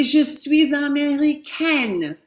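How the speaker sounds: put-on teacher modelling the girl's version; slow French model. Shown as a demonstration. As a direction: model the feminine slowly. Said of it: "it's gonna be".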